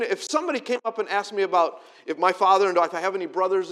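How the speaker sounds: usual speaking voice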